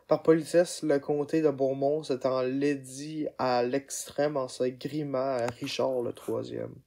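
usual speaking voice

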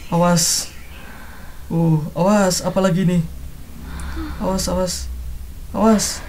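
low, soft nervous warnings; tense hushed warning. Real, not acted. Streamer creeping past danger in the game, hushed nervous warning. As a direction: warn under your breath, low and soft.

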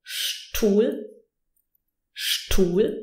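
"Stuhl" begun with sh, as shtool. Shown as a demonstration. Teacher giving the example for S T, sh at the start. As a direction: say "Stuhl" as sh-tuhl, carefully. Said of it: "the S is actually pronounced sh".

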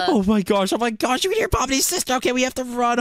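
very high-pitched alarm; panicked. Pretend. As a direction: squeal it in urgent panic. Hears the villain behind them.

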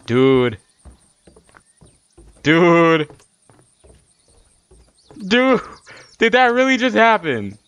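loud, high, amazed outburst; stunned disbelief. Real, not acted. Stunned reaction to in-game death.

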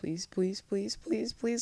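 soft, high anxious begging; small, high, repeated plea; pleading. Real, not acted. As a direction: plead under the breath, pitch climbing. Begging not to get hurt.

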